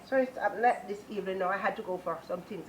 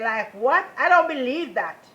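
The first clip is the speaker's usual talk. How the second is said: higher and louder, flatly refusing to believe; loud disbelief; 6 semitones higher, 11 dB louder than usual. Retold or imitated. Telling the man his wife's message is false, loud disbelief. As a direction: push the idea away hard. Rejecting the prophecy.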